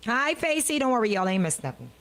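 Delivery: usual speaking voice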